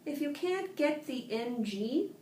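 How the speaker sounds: ordinary delivery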